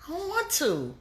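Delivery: high whiny "I don't want to", dragged out; mock whiny refusal. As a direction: play the sulky lazy person. Mocking a lazy whiner.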